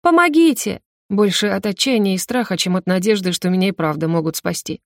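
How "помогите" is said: high despairing cry for help; screaming for help. A narrator's reading. Frightened girl screams for help, high despairing cry. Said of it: "Я тут же снова ору".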